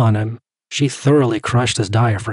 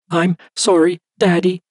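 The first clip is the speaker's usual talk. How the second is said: put-on high, hurt little girl; girl apologising to her father, near tears; near sobbing child; 8 semitones higher than usual. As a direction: hold back tears like a small child. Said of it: "between great heaves just shy of sobbing".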